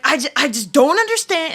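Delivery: high, loud, exasperated mock complaint; fired-up rant. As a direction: vent it like you're on fire at work. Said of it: "like, fire".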